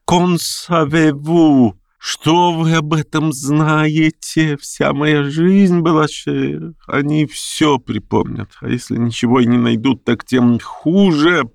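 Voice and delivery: slow, high fretting gentleman; lightly voiced frightened old intellectual; anxious old man. A narrator's reading. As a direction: dwell on each fear. Stepan frets, high and slow.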